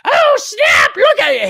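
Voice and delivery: very high, loud squawk; hammed-up alarmed yell; mock excited shout. Acted out fantasy dogfight.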